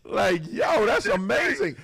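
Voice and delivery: loud, high, thrilled; excited amazement. Real, not acted. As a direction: hype it up, amazed. Host marvels at caller.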